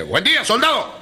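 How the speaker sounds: a hint of an officer hailing a private; officer's greeting.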